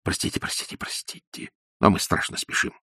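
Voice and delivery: breathless whisper, hurrying; hurried whisper. A narrator's reading. Ippolit whispers hurried apologies to Liza as he flees.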